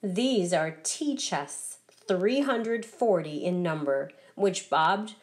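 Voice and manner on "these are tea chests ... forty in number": ordinary delivery, nothing marked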